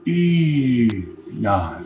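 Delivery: Ina, said slowly and carefully, clear i then na. Shown as a demonstration. Teacher giving learners the word to repeat. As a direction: model Ina as a clean two-syllable word. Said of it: "If you can say ina".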